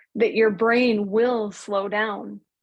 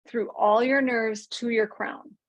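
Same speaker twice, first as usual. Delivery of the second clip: usual speaking voice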